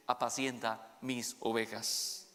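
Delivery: a quiet, loving charge; gentle Jesus. Acted. Jesus tells Peter to feed his sheep, low and gentle.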